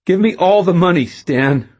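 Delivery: high, loud demand. A narrator's reading. Robber orders cashier Stan, demanding. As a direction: order him to hand it over.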